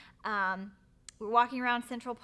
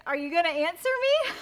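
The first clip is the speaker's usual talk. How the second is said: amused prompting; 8 semitones higher, 9 dB louder than usual. Retold or imitated. Fiancé retold, she laughs.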